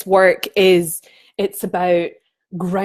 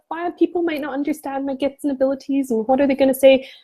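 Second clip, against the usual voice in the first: higher, anxious-sounding imitation; mock worried voice; 6 semitones higher, faster than usual. Mimicking women who fear being misunderstood, pitched up. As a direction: play the fretful doubter, pitched up. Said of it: "You're like".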